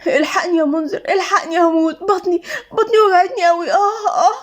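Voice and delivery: high, whining cries of pretend pain; faked agony. A narrator's reading. Siham fakes stomach pain for Munzer, hammed-up agony. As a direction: play up a bellyache with rising moans. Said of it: "سهام قالت وهي بتتوجع بتمثيل".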